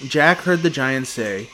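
plain reading voice

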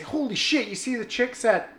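high, excited holy shit; a high, amazed gasp of a line. Retold or imitated. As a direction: blurt it out in wide-eyed surprise. Amazed at the women at Jet.

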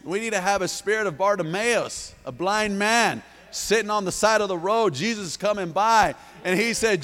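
loud, high, driving preacher's cry; full-force preaching. Acted. Pastor rousing the church to step out boldly, full-force preaching.

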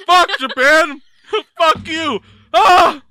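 high, very loud cursing through laughs; pained shouting. Real, not acted. Podcaster burning from Japanese hot sauce, shouting in real pain. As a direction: yell it as the burn hits.

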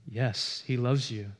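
ordinary talk

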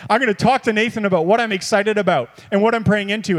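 ordinary talk, nothing marked

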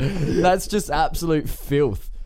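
laughing through the words. Real, not acted. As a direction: crack up while saying it. Laughing at own joke.

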